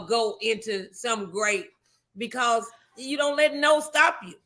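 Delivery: ordinary talk, nothing marked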